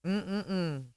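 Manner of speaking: normal talking voice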